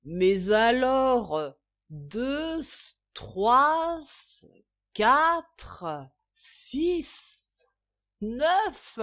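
put-on drunken counting. A narrator's reading. Drunk father counting empty bottles, fumbling and slurred. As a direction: count the bottles, losing track.

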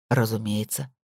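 low, quiet, matter-of-fact; cool low certainty. Acted. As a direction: answer coolly, as if obvious. Boot's cool low reply. («Разумеется» — тихо и холодно, с лёгким подъёмом)

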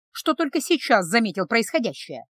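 ordinary delivery

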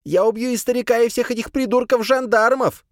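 high, loud hysterical threat; screaming threat. A narrator's reading. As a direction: scream it at everyone.

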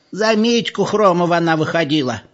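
put-on high-pitched old voice chiming in; old villager. A narrator's reading. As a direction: pipe up with the answer like an elder. Another old villager voiced in argument.

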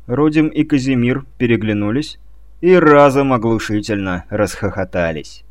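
ordinary delivery

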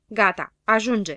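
slow, loud scolding command; snapping. A narrator's reading. Știe Tot to the quarrellers, snapping. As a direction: cut the quarrel short sternly. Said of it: "se răsti Știe Tot la certăreți".

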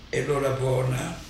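usual speaking voice

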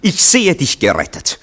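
high, loud teasing cheer; mock triumph. Max teasingly hailing Anatol as rescued, high and loud. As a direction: hail him as saved, teasing.

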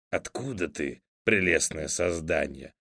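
grimacing grumpy voice. A narrator's reading. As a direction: grumble it with a sour face. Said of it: "поморщился Фыф".

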